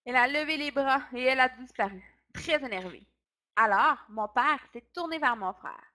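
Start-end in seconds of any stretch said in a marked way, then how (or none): none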